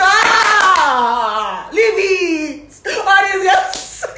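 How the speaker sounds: high, loud laughing cry; laughing shout. Real, not acted. Laughing shout about gossip.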